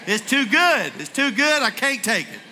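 exuberant exclaiming. Shown as a demonstration. Preacher leading congregation in joyful exclaiming. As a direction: shout it out with joy. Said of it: "Tell them".

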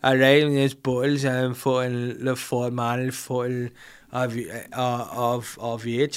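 halting, mumbled footballer impression; comic impression, losing the words as you go; Paul Gascoigne. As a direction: stumble slowly through a put-on regional voice. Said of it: "if Paul Gascoigne played Buckles".

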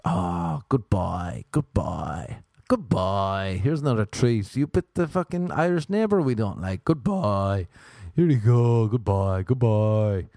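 cooing praise to a dog, hammed up; mock doting owner. Neighbors secretly rewarding their dog for biting him, mock doting. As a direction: lay on the treat-giving fondness.

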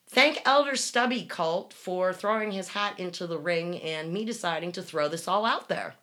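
ordinary talk

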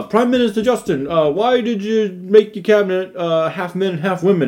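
flabbergasted reporter. Retold or imitated. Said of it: "He was like".

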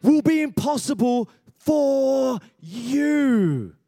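ringing declaration to the congregation; preached emphasis. Real, not acted. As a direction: lean into it at full preaching force. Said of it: "everybody say nothing".

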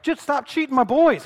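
high, loud coach's protest; yelling. Retold or imitated. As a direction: yell in outrage at the refs. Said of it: "He's yelling".

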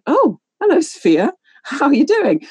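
put-on high, bright-eyed hello to an old acquaintance; mock delight at bumping into someone; imitating a chirpy surprised hello; surprised greeting. Acting surprised greeting in shop.

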